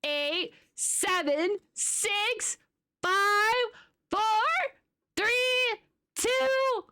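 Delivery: building, rising countdown cheer; new year countdown crowd. Real, not acted.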